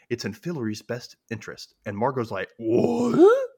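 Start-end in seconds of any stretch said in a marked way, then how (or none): 2.6-3.5 high, loud shocked cry; imitating queen's indignant shriek; outraged what. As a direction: blurt it in disbelief. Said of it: "She is upset".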